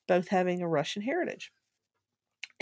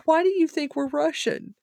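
incredulous Daddy; 8 semitones higher, 5 dB louder than usual. Retold or imitated. Father questions her.

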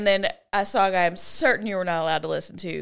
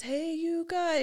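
movie quote; 7 semitones higher, 5 dB quieter, slower than usual. Retold or imitated. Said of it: "that's what he would always say".

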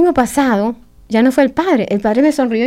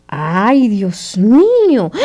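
dismayed cry; much slower than usual. Retold or imitated. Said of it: "era como ver".

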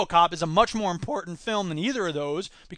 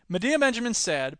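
ordinary talk, nothing marked

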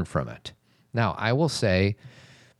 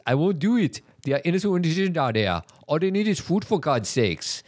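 higher Austrian action hero voice; Arnold Schwarzenegger; 8 semitones higher than usual. Retold or imitated. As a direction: do a bad Schwarzenegger. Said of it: "I do Arnold Schwarzenegger".

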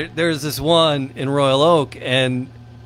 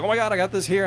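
excited retelling; 8 semitones higher, faster than usual. Retold or imitated. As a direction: imitate an excited find, pitched higher. Quoting someone's excited find.

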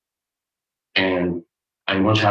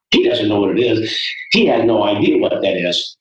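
ordinary delivery

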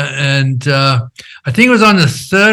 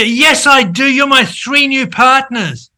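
higher, insistent retort, slowing down; indignant protest; 8 semitones higher, slower than usual. Retold or imitated. Arguing with his new partner on the phone, indignant and raised. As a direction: push back hard, disbelieving.

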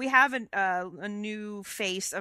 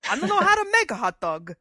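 put-on Fabio's Italian accent; 5 semitones higher, 9 dB louder than usual. Co-host imitating Fabio's talking-head interview, hammed-up Italian accent. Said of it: "he has this really terrific Italian accent".

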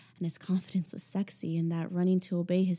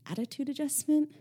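higher, incredulous repeat of "Attitude adjustment?"; 8 semitones higher than usual. Acted. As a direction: throw his words back in disbelief. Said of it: "Finally, I managed".

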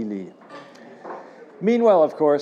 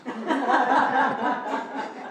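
high laugh after the punchline; burst of laughter; 10 semitones higher, 4 dB quieter than usual. Real, not acted. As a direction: cracks up at the joke. Laughs at own line.

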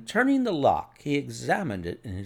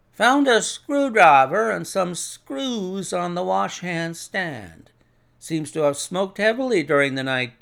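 a hint of a brisk inspector, pitched up; lightly voiced police inspector, matter-of-fact; 5 semitones higher, 6 dB louder than usual. Inspector reports on the hanged man to Holmes, lightly acted. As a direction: lightly play an inspector giving his findings.

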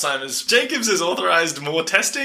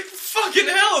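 high, exasperated dad curse; a hint of a fed-up father; mock annoyed dad; 13 semitones higher, slower than usual. Host voicing the dad who finds an ape in his yard. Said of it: "he's like".

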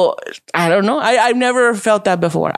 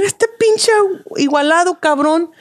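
pitched-up indignant cursing; mock outrage; 8 semitones higher than usual. Retelling how he cursed a rude customer, mock outraged. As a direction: act out the fury of being disrespected. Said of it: "De que me enojé".

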